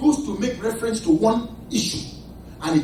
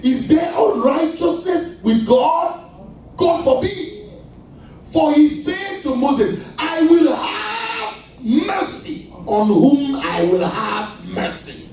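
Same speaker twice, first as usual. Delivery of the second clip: loud, slow preaching with stretched words; drawn-out, emphatic pulpit delivery; forceful preaching; 5 semitones higher, 4 dB louder, slower than usual. Real, not acted. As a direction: proclaim the scripture with weight.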